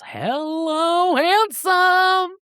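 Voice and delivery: high, drawn-out mock swoon; flirty greeting. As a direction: greet a hunk with playful flirtation. Reacting to the handsome stranger.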